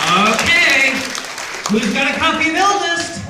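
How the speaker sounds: loud, high call to a crowd. Real, not acted. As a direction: call the question out across a hall. Calling out to the audience.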